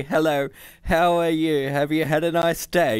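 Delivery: high, put-upon computer; indignant computer. A narrator's reading. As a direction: recite the polite greeting indignantly. Computer's demanded greeting.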